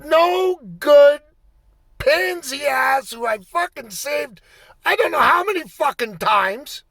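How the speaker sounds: high, loud furious screaming; furious yelling. Retold or imitated. Player screaming abuse at the referee from the bench, furious. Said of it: "I'm like".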